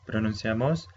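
usual speaking voice

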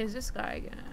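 usual speaking voice

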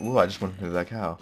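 usual speaking voice

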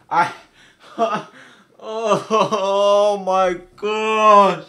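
high, loud, drawn-out cry of dismay; anguished disbelief. Real, not acted. Player has conceded at the last minute, loud anguished cry. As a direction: wail it very slowly in real disbelief.

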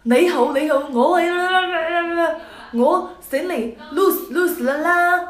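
slow, imitating Cantonese speech; hammed-up Cantonese; mock Cantonese. Imitating the man speaking fluent Cantonese. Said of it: "讲着一口非常流利的粤语".

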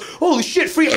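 delighted idiot spotting something free; a dipshit gasping at a free machine; dumb guy excited. Retold or imitated. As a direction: squeal it like a thrilled dipshit. Dipshit sees threshing machine.

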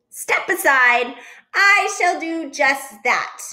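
high, loud-starting grand command; bossy. Acted. Duck pushes past Goose, high and self-important. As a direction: order it grandly, slowing down.

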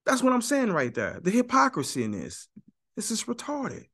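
normal talking voice

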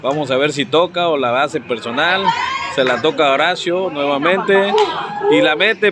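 loud, lively match call; live play-by-play. Real, not acted. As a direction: call the attack loud and quick.

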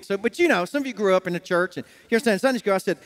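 usual speaking voice

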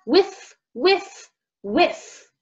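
whiff whiff whiff, high sniffs; story sniff sound effect. A narrator's reading. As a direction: sniff the air like a bear. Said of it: "He tilted his head up".

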